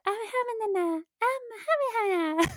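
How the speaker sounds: put-on very high, soft small child voice; little kid voice. Podcaster imitates the game's little boy, very high and soft. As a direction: squeak it out like a tiny scared kid. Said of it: "the kid, like, talks like this".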